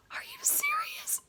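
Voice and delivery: stunned whisper, slow; shocked whisper. Retold or imitated. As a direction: breathe it out in disbelief. Shocked hero's reply.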